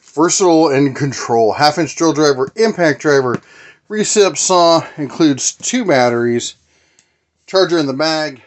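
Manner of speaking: punchy, slow announcer-style product read. Acted. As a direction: sell it like a TV commercial. Reads box copy like an ad.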